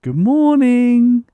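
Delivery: high, slow, loud sing-song greeting; cheery high morning call to the dog; sing-song good morning. Shown as a demonstration. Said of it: "if you do this tone of voice".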